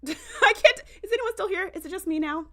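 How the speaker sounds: high, laughing disbelief; helpless laugh through the words. Real, not acted. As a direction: crack up while calling out to the audience. Laughs, wondering if viewers left.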